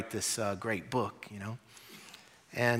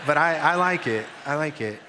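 laughing through the words; real laugh on a short admission; 4 semitones higher, 6 dB louder than usual. Real, not acted. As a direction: chuckle it out, a bit sheepish. Laughs admitting he likes banjo.

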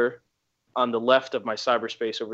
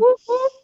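whoop whoop; 5 dB louder than usual. Pretend. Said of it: "can I get a too sweet".

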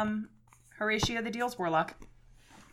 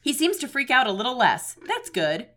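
normal talking voice

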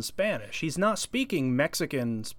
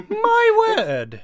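higher, louder, hammed-up posh Englishman; mock posh Brit; 6 dB louder than usual. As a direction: exclaim like a shocked toff. Mock posh British exclamation.

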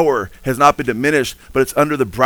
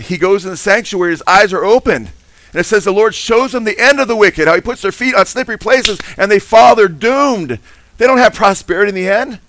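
rising, ringing preacher's voice, higher and louder; preaching at full force; 6 semitones higher, 3 dB louder than usual. Acted. Pastor to congregation about the psalmist, preaching full force.

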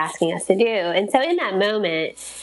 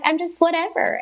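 lightly imitated husband, pitched up; shrugging husband; 9 semitones higher than usual. Wife quoting husband's answer on a boy, mock indifference. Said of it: "That's such a husband answer".

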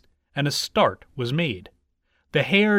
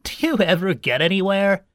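higher-pitched mocking hare; 4 semitones higher, 3 dB louder than usual. A narrator's reading. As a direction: taunt with a touch of scorn. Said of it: "he asked with a mocking laugh".

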